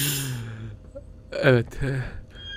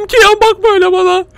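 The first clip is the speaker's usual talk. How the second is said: scared plea; 13 semitones higher, 6 dB louder than usual. Real, not acted. Scared of creature's eyes.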